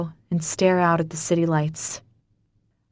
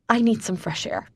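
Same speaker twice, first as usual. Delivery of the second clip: wavering voice; 4 semitones higher than usual. Acted. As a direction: voice wavering, holding back tears. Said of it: "my voice wavers".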